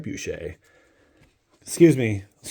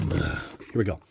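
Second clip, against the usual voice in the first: ordinary delivery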